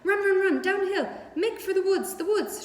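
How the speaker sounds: high, loud honking goose yell; goose shouting. A narrator's reading. Goose shouting to Wilbur to run for the woods. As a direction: shout the alarm like a frantic goose. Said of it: "she shouted to Wilbur".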